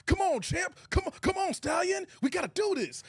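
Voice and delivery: high, hyped-up corner coach pep talk; Rocky trainer voice. Retold or imitated. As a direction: pump him up like Apollo in the corner. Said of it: "I'm like, "Is this Rocky three?".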